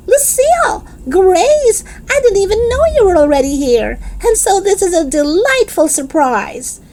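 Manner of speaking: high, fast, delighted greeting. Acted. Girl greets her friends in the car, high and delighted. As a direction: gush over the surprise.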